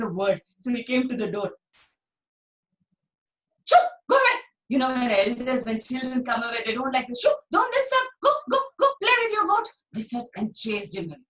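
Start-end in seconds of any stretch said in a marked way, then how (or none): 3.7-4.4 high, loud shooing; shooing elders. Acted. Elders loudly shooing Dhani away from the meeting. 7.2-9.7 high, loud shooing; shooing elders. Acted. Elders loudly shooing Dhani away from the meeting.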